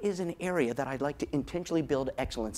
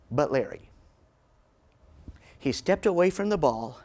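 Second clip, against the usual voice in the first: ordinary talk, nothing marked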